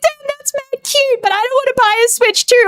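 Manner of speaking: high, small, wistful whine; squeaky mock-pitiful longing; retold near-tearful longing. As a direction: go high and plaintive, as if nearly crying. Retells almost crying over a game.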